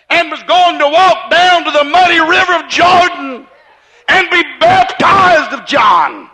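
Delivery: building from slow to a ringing shout; preacher driving toward John's baptism; full-force preaching. Acted.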